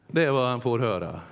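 usual speaking voice

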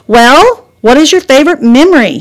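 loud, high impatient push; a child nagging her mother for an answer. Acted. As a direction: demand the answer, fed up. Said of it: "Hannah was getting very impatient".

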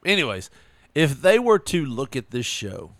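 ordinary talk, nothing marked

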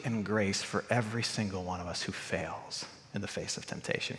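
low, soft, reverent close; hushed solemnity. Real, not acted. Preacher telling the congregation of mercy and forgiveness, hushed and solemn. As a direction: drop right down and let each phrase settle.

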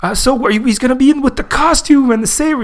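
high, excited fan caricature; eager superfan. Retold or imitated. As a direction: ask hopefully as a hammed-up fanboy. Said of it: "He's like".

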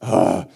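loud, low huff of frustration; acted fed-up "ugh"; exasperated groan. Jesus fed up with the sign-seekers, exasperated groan. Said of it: "do you feel his, like".

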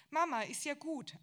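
loud, high, fed-up cry; exasperated outburst. A narrator's reading. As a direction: cut her mother off, loud and high. Tochter bremst die Mutter. (laut und hoch, genervt)

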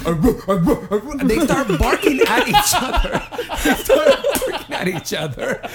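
high, helpless laughter through the words; laughing while talking. Real, not acted. Friend telling how the men barked at each other, laughing. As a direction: crack up mid-story.

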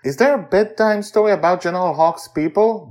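put-on high, eager child asking; a small girl begging for a bedtime story; little girl voice. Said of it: "Joy asks".

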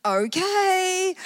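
a squeaky, incredulous okay; stunned okay. Retold or imitated. Woman stunned as the praying lady wants to blow on her stomach, high disbelief.

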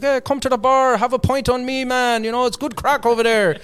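high, loud Irish pub voice; a cheery Irish mate calling you over for a pint; Irish accent. Retold or imitated. Irish friend inviting narrator to the bar, in a high, loud Irish accent. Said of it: "You know, he's this Irish guy".

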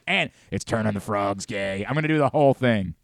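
ordinary talk

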